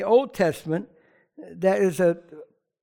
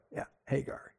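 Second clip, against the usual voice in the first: Yeah, Hagar whispered, much softer; knowing whisper; 7 semitones lower, 14 dB quieter than usual. Real, not acted. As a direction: breathe out Hagar like a secret aside. Repeats name, then whispers it.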